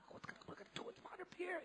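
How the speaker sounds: put-on hushed boys conferring; whisper. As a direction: whisper it to each other, alarmed. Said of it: "they're going".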